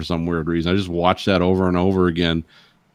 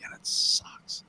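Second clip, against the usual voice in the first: deadpan whispered punchline; 11 dB quieter, slower than usual. Acted. Friend repeating the movie joke, whispered. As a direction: drop to a whisper for the verdict.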